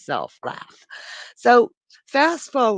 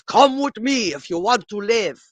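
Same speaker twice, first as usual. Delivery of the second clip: louder, hammed-up movie-hero delivery; mock action hero line; 4 semitones higher, 6 dB louder than usual. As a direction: play it as a deadpan blockbuster rescue joke. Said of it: "that's not what he said".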